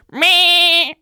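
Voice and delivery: high, loud drawn-out bleat; sheep impression. Shown as a demonstration. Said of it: "I'm gonna do my best sheep impression".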